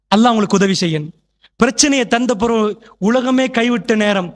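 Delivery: ordinary talk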